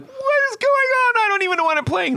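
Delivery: very high, squeaky panicked wail; comic squeal of onstage panic; mock panic. Playing a strange baritone guitar, squeaky mock panic.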